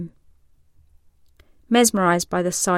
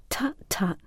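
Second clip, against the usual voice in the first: soft scolding whisper; tut-tut under your breath; 6 dB quieter than usual. Acted. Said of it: "she tells him".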